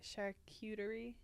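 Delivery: charcuterie said as shar-kyoo-ter-ee, softly. Shown as a demonstration. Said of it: "what do I say?".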